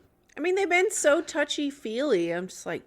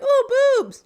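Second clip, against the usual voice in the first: much higher and louder, a hammed-up ooh; playful fake eagerness; mock excitement; 12 semitones higher, 8 dB louder than usual. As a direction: pretend thrill at the screen. Hoping for nudity, mock thrill.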